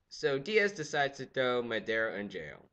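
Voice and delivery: usual speaking voice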